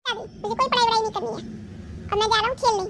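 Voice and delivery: put-on high, loud bratty child yelling back; a spoilt boy storming off to play; defiant boy shout. Grandson refuses to study, leaves. (ऊँची, ज़ोरदार ज़िद्दी बच्चे की आवाज़)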